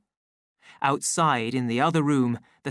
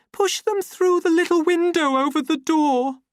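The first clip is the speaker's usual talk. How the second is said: anxious Lenina; 7 dB louder than usual. A narrator's reading. Lenina from bathroom.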